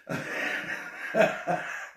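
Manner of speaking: a short burst of chuckling; laughing. Real, not acted. Host laughs at having only four listeners, amused chuckle.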